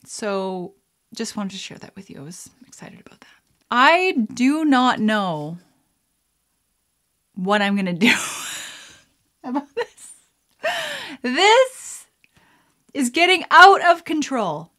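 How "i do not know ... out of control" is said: loud, raised-pitch mock despair; hammed-up exasperation. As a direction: build to a high, loud cry of exasperation. Hammed-up exasperation at things getting out of control.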